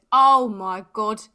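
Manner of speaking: loud, slow, drawn-out awe; booming, stretched-out exclamations of delight; acted amazement. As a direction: quote a thrilled rider, big and emphatic. Quoting friend thrilled after the ride.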